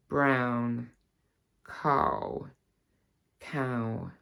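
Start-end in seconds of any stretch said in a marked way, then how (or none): 1.7-2.5 'cow' with an RP vowel, dropping low; RP accent. Shown as a demonstration. Teacher contrasting RP with her own accent, RP accent. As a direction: RP accent, placed on the bottom lip. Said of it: "show you the difference between".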